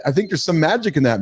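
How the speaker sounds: normal talking voice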